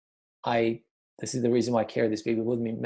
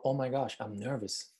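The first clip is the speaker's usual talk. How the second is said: nervous; 7 dB quieter than usual. Shown as a demonstration.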